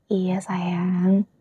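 soft, close whisper; tender whisper. Acted. Girlfriend reassuring her boyfriend she trusts him, whisper. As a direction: whisper your trust gently.

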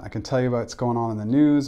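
usual speaking voice

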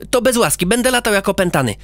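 rushing off in a sulk; quick cheeky boy voice; boy character. Acted.

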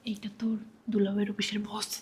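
low, very soft whisper; hushed warning. Acted. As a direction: whisper urgently so the guest won't hear. Hushed warning in front of guest. (ফিসফিস করে সাবধান করা)

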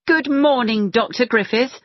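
pointed, loud good morning; a pointed loud greeting to the doctor who missed her. Acted. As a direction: call it out so he can't ignore her. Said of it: "said Joanna loudly".

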